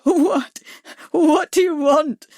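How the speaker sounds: high, loud tearful plea; weeping. A narrator's reading. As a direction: choke it out through tears. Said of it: "Bags was openly weeping now".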